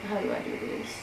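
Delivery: hushed, unvoiced nervous question; nervous whisper. Real, not acted. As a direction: whisper it, afraid to mess up. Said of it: "I'm scared".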